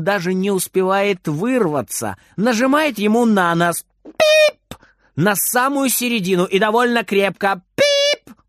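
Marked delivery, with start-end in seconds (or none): 4.0-4.7 very high electronic beep; high-pitched button beep; imitated beep. A narrator's reading. 7.8-8.3 very high electronic beep; short, high peep; imitated beep. A narrator's reading. Imitated beep of nose button.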